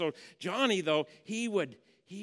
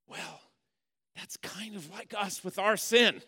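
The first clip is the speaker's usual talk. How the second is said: ordinary delivery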